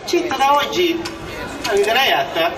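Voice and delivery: usual speaking voice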